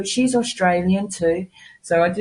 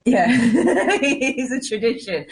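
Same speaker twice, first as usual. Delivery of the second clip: higher, slow laughing agreement; 7 semitones higher, slower than usual. Real, not acted. Guest agreeing about the Santa tradition, laughing. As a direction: laugh through the words, drawn out.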